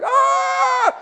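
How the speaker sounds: very high, sustained shriek; hammed-up wail of alarm; mock freak-out scream. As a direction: imitate a panicked family screaming. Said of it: "all of us are just freaking out".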